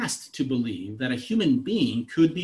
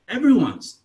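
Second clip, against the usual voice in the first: emphatic burst; 8 semitones higher, 7 dB louder than usual. Retold or imitated. Peak of the sermon quote.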